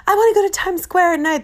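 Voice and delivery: high, loud starry-eyed tourist gush. Retold or imitated. To eye-rolling New York friends, a high, loud tourist gush. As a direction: squeal it like an eager out-of-towner.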